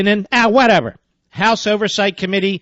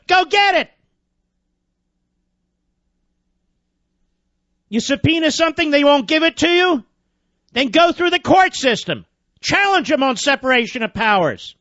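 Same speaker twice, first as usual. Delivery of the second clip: high-pitched, impatient commands; exasperated rant; 8 semitones higher than usual. Real, not acted. Host lectures chairmen on enforcing subpoenas, exasperated. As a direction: goad them into action, pitch well up.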